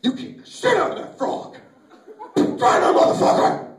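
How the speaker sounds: rough, loud outburst; acted yelling. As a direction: yell it in a hammed-up frenzy. Acts out yelling in his fantasy.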